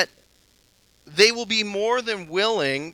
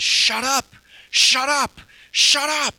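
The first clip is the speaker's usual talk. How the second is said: higher and louder with each shut up; retold screaming; 7 semitones higher, 7 dB louder, slower than usual. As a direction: scream it in the man's face on the bus. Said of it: "he screams at the man".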